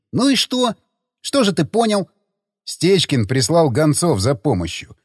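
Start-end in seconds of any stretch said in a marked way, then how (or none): 0.1-2.1 high-pitched, impatient question; baffled challenge. Acted. Friend questioning the self-declared drunk-language expert, baffled. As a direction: press for an answer, incredulous. Baffled man presses for answer.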